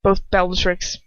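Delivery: usual speaking voice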